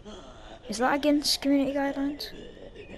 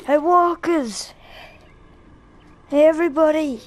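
calling out; 4 semitones higher, 5 dB louder than usual. Real, not acted. On a car roof, calling the walkers over, loud shout. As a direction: yell to get the walkers' attention. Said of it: "call out to the walkers".

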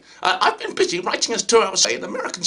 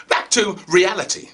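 ordinary delivery

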